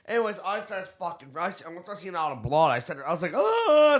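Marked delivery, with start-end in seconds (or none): 3.3-4.0 high, loud wailing "Oh"; mock crying. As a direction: start of a sob. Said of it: "I started crying".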